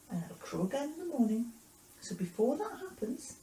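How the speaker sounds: lifted, measured prediction; Jesus foretelling. Acted. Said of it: "Jesus said".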